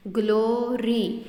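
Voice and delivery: Glory in two slow beats, "glo - ree"; split into syllables. Shown as a demonstration. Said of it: "दो part में जब आप बोल रहे हैं". (ग्लोरी को दो हिस्सों में तोड़कर, धीरे)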